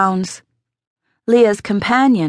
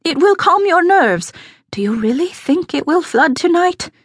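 high, anxious pleading; trembling with worry; 9 semitones higher than usual. A narrator's reading. Pasqua soothing the aldermaston about the flood, trembling with worry. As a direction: keep it high and worried, rising to a question. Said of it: "her voice trembling with worry".

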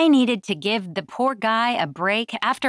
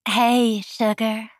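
purring, seductive Southern phone greeting; throaty Southern drawl; 2 semitones higher, much slower than usual. A narrator's reading. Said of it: "purred in my throaty southern drawl".

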